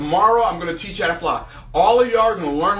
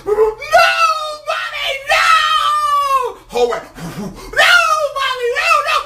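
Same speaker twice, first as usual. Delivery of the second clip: very high, loud screaming no; dragged kid wailing for mercy; terrified baby eagle; 4 dB louder, slower than usual. Acted. Eaglet pleads with Mommy as she flies, very high screams.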